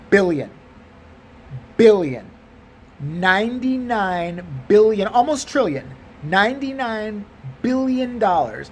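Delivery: "Billion" punched out alone with long pauses; emphatic repetition. Acted. Teller repeating the absurd debt on request, slow and emphatic. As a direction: stretch "Ninety-nine billion" slowly and weightily.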